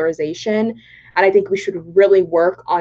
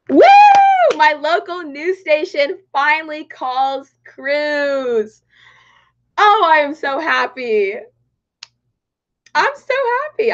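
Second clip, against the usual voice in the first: excited; 7 semitones higher, 4 dB louder than usual. Real, not acted. Election result comes in live.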